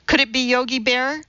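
mildly testy teacher; irritable. A narrator's reading. Teacher corrects a pupil's silly guess, irritable. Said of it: "Missus Mudford said irritably".

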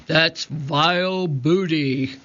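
slow pirate contempt; disgusted pirate captain. A narrator's reading. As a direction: drag out each word in disgust.

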